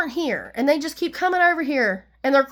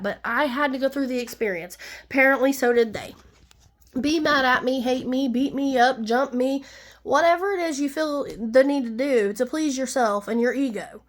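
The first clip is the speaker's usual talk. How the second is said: usual speaking voice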